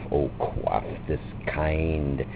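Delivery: usual speaking voice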